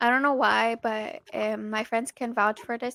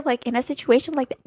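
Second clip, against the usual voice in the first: ordinary delivery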